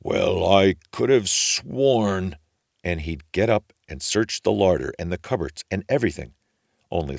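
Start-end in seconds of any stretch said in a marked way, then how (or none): 0.0-2.4 slow, lightly voiced ogre grumble; mild ogre mutter. A narrator's reading. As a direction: grumble slowly to himself. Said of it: "he would mutter".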